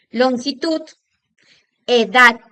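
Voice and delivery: longitud, edad with weight on TUD and DAD; final-syllable stress. Shown as a demonstration. Teacher giving examples of last-syllable accent, stressed endings in longitud, edad. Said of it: "van a tener también un acento en la última sílaba".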